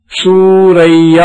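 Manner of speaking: సూరయ్య stretched out very slowly, each syllable clear; slow model word. Shown as a demonstration. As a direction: read సూరయ్య slowly to the class. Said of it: "గుర్తించారు కదా".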